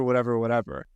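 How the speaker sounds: normal talking voice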